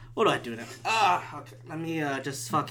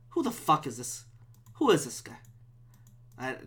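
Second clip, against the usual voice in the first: usual speaking voice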